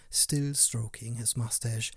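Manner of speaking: plain reading voice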